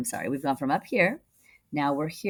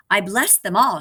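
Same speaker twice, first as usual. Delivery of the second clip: raised, loud regal blessing; kingly proclamation; 5 semitones higher, 8 dB louder than usual. Acted. Storyteller voicing the king to the puppets, loud and regal. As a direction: bless them like a proud king.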